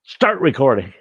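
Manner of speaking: loud barked voice command; mock yell at a stubborn camera. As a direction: call it out at the camera. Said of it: "I can yell at it".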